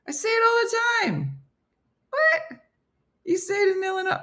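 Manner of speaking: very high, squeaky disbelief; incredulous. Acted. Reacting to viewers who say it too, high incredulous squeal. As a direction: shoot the pitch way up in mock astonishment.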